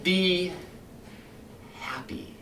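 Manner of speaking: a smooth, menacing captain talking his men round; silky, sinister fire captain addressing his firemen; Captain Beatty lecturing. Acted.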